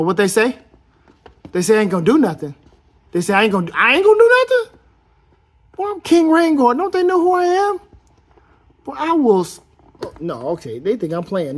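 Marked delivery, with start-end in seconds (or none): 1.5-9.6 much higher, louder, faster mock bluster; hammed-up king shouting his name; mock boastful rant. King Rhaegar boasting to the doubters, high hammed-up shout.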